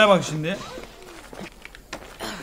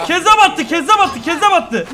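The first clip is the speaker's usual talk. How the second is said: laughing excitedly; 8 semitones higher, 6 dB louder than usual. Real, not acted. Reacting to game chaos.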